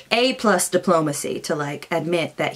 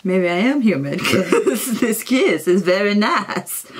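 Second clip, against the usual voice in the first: laughing wonder; slower than usual. Retold or imitated. Nemesis amazed by kiss.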